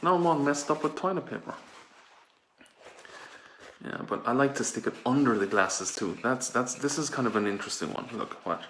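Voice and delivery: ordinary delivery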